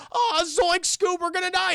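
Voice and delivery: high-pitched cartoon panic; Shaggy. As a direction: wail as the doomed goofy sidekick. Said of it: "He's like".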